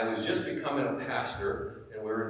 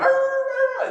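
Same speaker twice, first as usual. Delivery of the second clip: very high, loud mock snarl; playful dog growl imitation; imitated dog snarl; 9 dB louder than usual. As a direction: snarl like an angry big dog. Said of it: "And I went".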